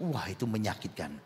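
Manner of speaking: ordinary talk, nothing marked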